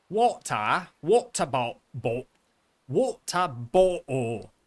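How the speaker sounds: loud, stretched "water bottle" with British t's; mock British accent. Streamer mocking British viewers, loud hammed-up accent. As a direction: overdo the Cockney "wa'er bo'le".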